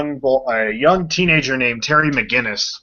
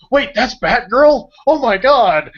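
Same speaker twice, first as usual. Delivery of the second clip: put-on high, loud, awestruck kid's gasp of recognition; the thrill of a kid spotting his hero, a bit slow; childhood amazement; 11 semitones higher, 6 dB louder, slower than usual. Remembering watching the show as a kid, excited cry. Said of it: "I was just like".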